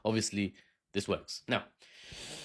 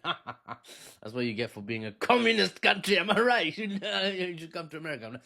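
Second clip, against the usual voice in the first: hammed-up mocking agent; 4 semitones higher than usual. Agent taunting Villegas about communism, hammed-up mockery. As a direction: build to a mock-triumphant jeer, half laughing.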